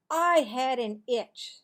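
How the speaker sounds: loud protest; defensive boy. Acted. Marvin to Cassie, loud defensive denial. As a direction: deny it hotly.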